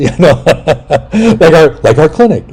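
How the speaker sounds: usual speaking voice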